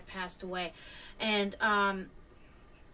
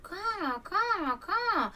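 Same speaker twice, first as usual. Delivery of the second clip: lightly pitched-up caw, caw; imitated hawk caws; 9 semitones higher, much slower than usual. Describing the persistent hawk, mimicked high caws. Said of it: "he just kept".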